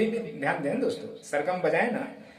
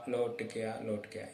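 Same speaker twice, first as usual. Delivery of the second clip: softer, fading; softer repeat; 5 semitones lower, 8 dB quieter than usual. Shown as a demonstration. Teacher repeating the line softer for the students. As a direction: let the phrase fade away. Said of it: "ये देखो".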